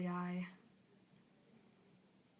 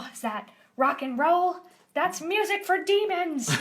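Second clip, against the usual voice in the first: high, loud cartoon alarm; hammed-up shocked outcry; scandalized character; 9 semitones higher, 10 dB louder than usual. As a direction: exclaim in mock horror at sinful music. Character shocked by rock music.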